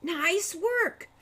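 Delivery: high, breathy gasp of relief; gasped praise. A narrator's reading. Hawk gasps his thanks to Al, breathless. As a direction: gasp the words out between breaths. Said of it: "gasped Hawk".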